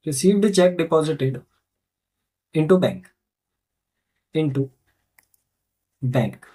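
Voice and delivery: low, drawn-out phrases with long pauses; slow dictation. Real, not acted. Teacher writing the narration for students, slow dictation. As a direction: dictate it slowly as if writing along.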